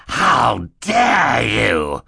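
drawn-out, high, loud outrage; furious shout. A narrator's reading. Stefano confronts Mister Poe at the crash, shouting. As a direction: roar it very slowly in fury. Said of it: "Stefano shouted".

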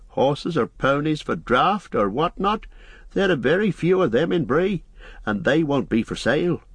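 high-pitched, swooping innkeeper voice; unhappy landlord. A narrator's reading. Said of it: "said the landlord unhappily".

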